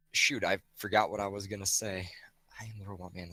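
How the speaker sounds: hushed whisper aside; breathy, unvoiced whisper. Real, not acted. Sudden whispered aside on stream.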